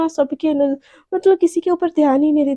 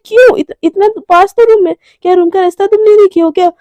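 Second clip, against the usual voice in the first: high, loud flustered retort; awkward protest; 4 semitones higher, 7 dB louder than usual. Retold or imitated. Garima brushing off Priyanka's wish to walk together, flustered. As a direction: blurt it out, embarrassed and defensive. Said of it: "ऐसे बोल के awkward होके".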